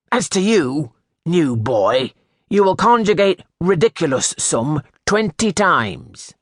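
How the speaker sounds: high, loud stern sentence of punishment; the master punishes the new boy. A narrator's reading. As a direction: lay down the penalty with authority.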